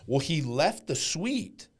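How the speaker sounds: ordinary talk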